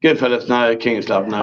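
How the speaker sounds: low, dreary imitation of a bored reader; mocking unenthusiasm. Host teasing quiz partner over his dull reading, mock-bored. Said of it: "Have a bit of enthusiasm".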